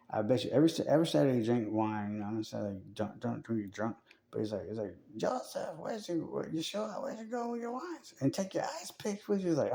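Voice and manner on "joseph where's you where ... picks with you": much higher, soft nagging parent; lightly hammed-up nagging parent; mock parent voice. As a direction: call after him like a fussy mom. Parents voiced calling to Jesus.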